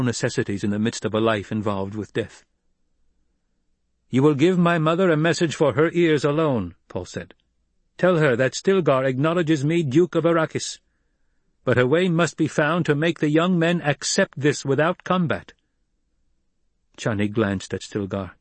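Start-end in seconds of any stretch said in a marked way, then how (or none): 4.1-6.7 higher, louder formal order; Paul, commanding young leader. A narrator's reading. Paul sending Chani with a message, commanding. 8.0-15.5 higher, firm dictated message; Paul, commanding young leader. A narrator's reading. Paul dictating a message for his mother, commanding.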